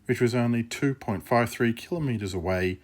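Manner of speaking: loud storybook narrator; story narration. A narrator's reading. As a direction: announce the scene change boldly. Narrator bridges to next scene.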